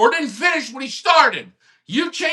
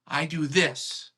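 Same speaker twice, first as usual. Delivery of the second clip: worked up, suddenly quiet; 8 semitones lower, 11 dB quieter, slower than usual. Real, not acted. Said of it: "Maybe I'm a little worked up".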